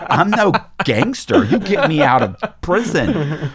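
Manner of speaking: high, laughing disbelief at his own thought. Retold or imitated. Amused at himself, recalling what he thought. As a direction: squeal it out through laughter, slowing at the end.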